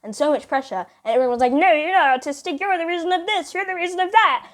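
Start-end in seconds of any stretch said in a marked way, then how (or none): 1.5-4.5 high, loud piling-on accusations; accusing crowd. Retold or imitated. Classmates all coming at her, high mock accusing clamour. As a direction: mimic kids ganging up, pitch climbing high.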